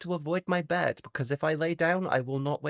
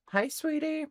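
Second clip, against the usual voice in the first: high, sing-song hi sweetie; a sugary hello to a viewer; sweet high greeting; 13 semitones higher than usual. Real, not acted.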